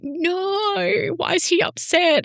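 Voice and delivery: high, squeaky mock dismay; playful high-pitched pity; mock distressed squeal. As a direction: squeal it like you're heartbroken for him. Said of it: "I was just like".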